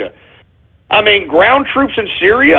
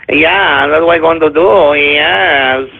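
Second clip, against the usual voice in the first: low, droning mock-French accent; mock French bureaucrat; 6 semitones lower, slower than usual. Voicing Le Pen backing down to the people, mock French accent. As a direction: put on a lazy French accent, pitched low. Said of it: "your typical quintessential bureaucratic woman move".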